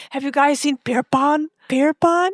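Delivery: high, searching call for a lost gnome; calling out anxiously for Pierpont; Babette. Retold or imitated. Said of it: "she's like".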